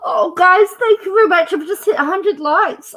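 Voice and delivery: gasp, then high, loud, thrilled thanks; overjoyed, gushing thanks. Real, not acted. Host thanking viewers for a hundred likes, thrilled.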